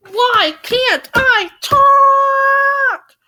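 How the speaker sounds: drawn-out high wail of frustration; frustrated outburst. Real, not acted. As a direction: yell it loud and high, stretching the last word. Frustrated outburst at flubbed line.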